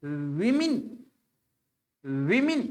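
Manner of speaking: विमिन said as wi-min, short i in both syllables; correct pronunciation. Shown as a demonstration. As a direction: say women as विमिन. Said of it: "इसका sound होता है".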